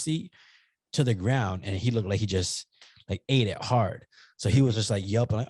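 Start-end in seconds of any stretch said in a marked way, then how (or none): none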